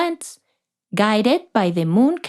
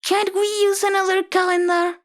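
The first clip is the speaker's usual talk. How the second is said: put-on a reluctant boy pleading with the teacher; boy Paulo; 9 semitones higher than usual.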